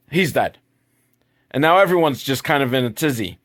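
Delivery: ordinary talk, nothing marked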